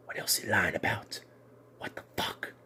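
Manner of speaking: hushed unvoiced disbelief; breathless mock-whispered accusation. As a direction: whisper it like a stunned secret. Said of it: "It's like".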